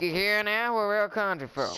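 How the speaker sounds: hammed-up West Virginia twang; country accent. West Virginian showing off to a teammate, country accent. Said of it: "[name] are West Virginians".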